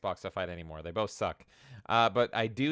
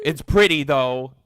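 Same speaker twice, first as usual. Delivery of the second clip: sarcastic jab; 2 semitones higher, 12 dB louder than usual. Real, not acted. Streamer mocking the fanboys' praise, loud and sarcastic. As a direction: snap back at the fanboys.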